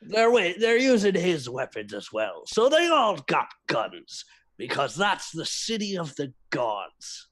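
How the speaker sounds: put-on high, lilting drunken pirate storyteller; put-on pirate brogue, pitch swooping up. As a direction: spin a grand yarn like a tipsy sea dog. Player continues the pirate's yarn.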